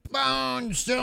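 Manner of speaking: high, soft little Bone Storm; imitating the pet. Teasing a friend about the annoying pet, high soft squeak. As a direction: coo it like the tiny pet. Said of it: "the little pet going".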